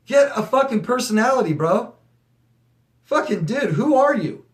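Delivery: high, exasperated get a fucking personality; exasperated rant. Real, not acted. Ranting at the ex who copied her, high and exasperated. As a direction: vent at the copycat. Outburst at copycat ex.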